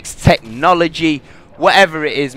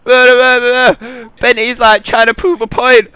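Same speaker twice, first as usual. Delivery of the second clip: much higher, louder mocking blather; mocking friends; 10 semitones higher, 7 dB louder than usual. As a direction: parody the friends' bragging in a silly voice. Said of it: "They were like".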